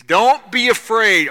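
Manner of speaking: loud, slow, firm "Don't be afraid"; commanding reassurance. Acted. Jesus calling to frightened disciples, loud and slow. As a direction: plant each word, strong and slow. Jesus's words, then repeated softly.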